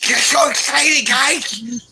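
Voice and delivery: high, slow, giddy nerd voice; Neil Goldman voice. Retold or imitated. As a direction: squeal it like an overexcited nerd. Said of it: "I love to do Goldman voice".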